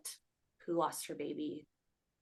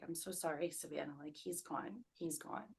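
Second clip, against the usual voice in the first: soft, subdued retelling of the midwife; gently breaking news; 9 dB quieter than usual. Retold or imitated. Midwife telling the mother her baby is gone, hushed. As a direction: quote the bad news in a hushed voice.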